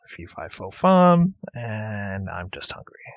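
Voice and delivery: a giant booming fee fi fo fum; ogre voice. Retold or imitated. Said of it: "That's what the ogre says".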